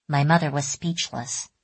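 plain reading voice

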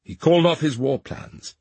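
usual speaking voice

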